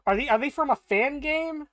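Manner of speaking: baffled asking; real disbelief; incredulous question. Real, not acted. Streamer confused.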